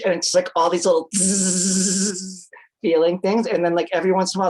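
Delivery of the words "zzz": imitated buzz. Describing little laser zaps on the face, imitated buzz. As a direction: stretch out the machine's zzz.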